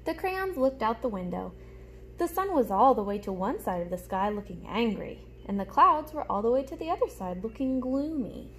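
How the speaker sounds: put-on lively storybook reading for little kids; reading to children. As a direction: read it like story time for a class. Reads picture book aloud.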